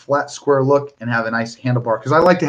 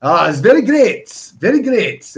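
a hammed-up Parisian French accent, slowed; bad Parisian accent; 4 semitones higher than usual. Thanking a fan from Paris in a bad Parisian accent. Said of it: "As terrible as Perry accent that was".

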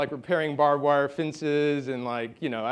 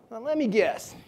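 higher-pitched imitation Midwestern accent; 7 semitones higher than usual. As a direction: play the bartender with an attempted Midwestern accent. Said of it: "try to do a Midwestern accent".

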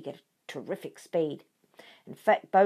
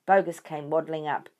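plain reading voice

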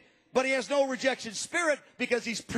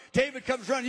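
ordinary delivery

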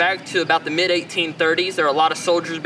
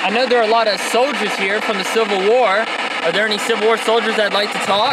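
calling out to spirits; 4 semitones higher than usual. Acted. Investigator addressing spirits at the cemetery, a little raised. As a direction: call out to anyone listening.

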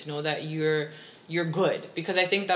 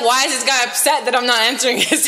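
raised, higher-pitched exasperated quote; mock exasperation; 5 semitones higher, 9 dB louder, much faster than usual. Friend arguing about texting, imitating an exasperated complaint. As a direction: voice an annoyed complaint, loud and high. Acting out annoyance in argument.

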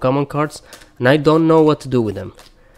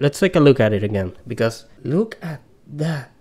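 normal talking voice

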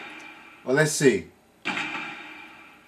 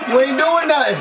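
high shouted Jamaican taunt; Jamaican accent; 11 semitones higher, 4 dB louder than usual. Retold or imitated. Player taunting the opponent in a Jamaican accent. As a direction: yell the taunt dancehall style. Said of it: "I can't do the accent right".

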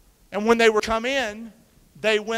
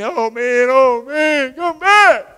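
rising, louder cry to come back; pleading call; 7 semitones higher, 13 dB louder, much slower than usual. Retold or imitated. Preacher as disciple crying up to heaven, rising. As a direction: call up after him, louder each time. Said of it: "I'd be doing saying".

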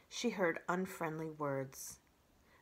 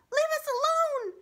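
tiny high squeak, loud; squeaky sparrow; 9 dB louder than usual. A narrator's reading. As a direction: squeak like a scared little bird. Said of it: "squeaked Dart".

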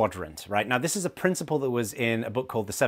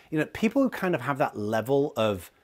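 usual speaking voice

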